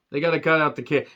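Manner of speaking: usual speaking voice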